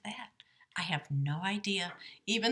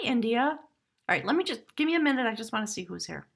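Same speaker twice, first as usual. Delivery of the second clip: ordinary delivery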